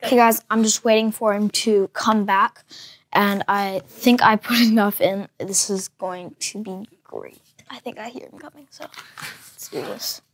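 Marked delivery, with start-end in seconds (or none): 5.4-10.2 hushed whisper. Real, not acted. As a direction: hush it for the camera. Victim coming back, sneaky aside.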